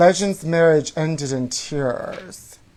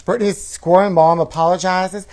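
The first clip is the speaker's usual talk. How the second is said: ordinary delivery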